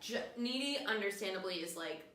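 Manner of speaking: ordinary talk, nothing marked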